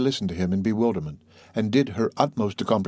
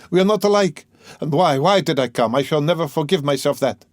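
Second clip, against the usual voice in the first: high, loud self-reproach; anguished cry; 7 semitones higher, 5 dB louder than usual. A narrator's reading. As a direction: burst out, cursing your own visit. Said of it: "he cried again".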